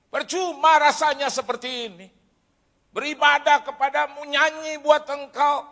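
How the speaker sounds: loud, high-pitched protest to God; shouted complaint. Retold or imitated. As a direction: cry out your grievance to heaven. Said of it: "Saya teriak-teriak itu".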